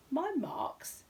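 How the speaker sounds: high, puzzled bear voice; puzzled Paddington. Acted. Bear puzzles at the cameraman, high and confused. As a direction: wonder aloud, confused.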